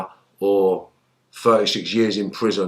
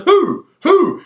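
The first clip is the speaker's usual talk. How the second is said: retold shocked shout; 7 dB louder than usual. Shocked reply to friend's news.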